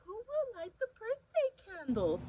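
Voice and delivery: high, bright-eyed mother's question; mother asking. Acted. Mother asking the family at the cake. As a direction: lift it up like a mom teasing. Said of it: "his mother asked".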